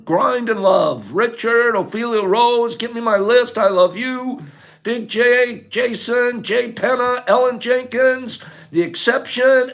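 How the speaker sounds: high, loud affectionate shout-outs; calling out farewells. Real, not acted. Streamer signing off to viewers by name, calling out. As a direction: holler each name with love.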